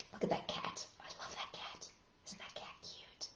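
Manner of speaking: soft unvoiced whisper. Storyteller whispering to children about the cute cat. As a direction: whisper it like a secret to kids.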